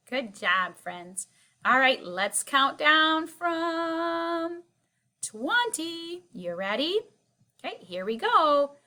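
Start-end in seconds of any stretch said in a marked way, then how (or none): 1.6-6.2 excited. Acted. Announcing countdown to class.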